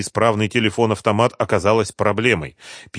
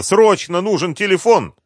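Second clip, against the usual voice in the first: high, loud, frantic demand; raised voice, pitched well up; urgent shout; 10 semitones higher, 5 dB louder than usual. A narrator's reading. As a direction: shout for help in a hurry. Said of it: "кричала Римма".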